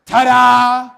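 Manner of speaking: loud showman's flourish; triumphant ta-da. Acted. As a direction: present it like a magician. Presenting exit loudly.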